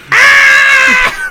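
very high, loud shriek; piercing acted cry of horror; mock scream. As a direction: let out a long demonstrative scream. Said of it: "But not even like a".

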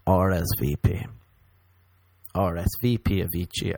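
usual speaking voice

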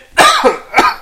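harsh wet cough; gross cough. Real, not acted. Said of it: "holy shit, that sounded gross".

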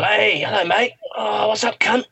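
high, brash Aussie lad greeting, imitated; mock Australian accent. Australian guys barging into his hostel room, mock Aussie accent. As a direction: ham up a coked-up Australian bloke saying hi. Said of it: "And they go".

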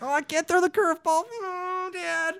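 very high, whimpering mock kid voice; teasing imitation of a kid wailing for dad; whiny crying kid. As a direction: mimic a kid about to cry.

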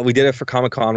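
ordinary talk, nothing marked

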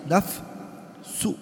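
nafsu said unvoiced, ending in a rising u; whispered nafsu. Shown as a demonstration. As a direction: breathe nafsu out with a questioning u at the end. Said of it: "Why are you saying nafsu".

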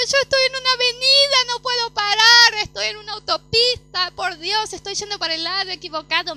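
frantic, nearly shouting; desperate panic. Retold or imitated. Daughter on the phone, panicking to her father. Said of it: "Me desesperé mal".

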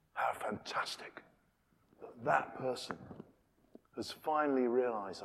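awed whisper. Real, not acted. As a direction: breathe it out in wonder. Hushed aside.

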